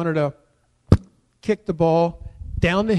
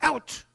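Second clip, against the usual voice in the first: short, high yelp of "ouch"; acted ouch; 9 semitones higher, 4 dB quieter than usual. Storyteller acting out being hit by kickball, high yelp.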